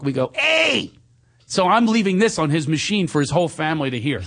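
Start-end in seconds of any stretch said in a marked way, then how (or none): 0.3-0.9 high squeaky hey; monkey talk. Acted. Greeting Munchak in monkey talk. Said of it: "we talk monkey talk".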